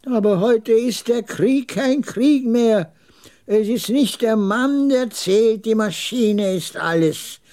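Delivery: put-on high, slow old man's lament; drawn-out, high-pitched old veteran; old camel trader, resigned. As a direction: pronounce it slowly in a high old voice.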